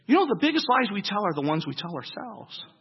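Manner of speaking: ordinary delivery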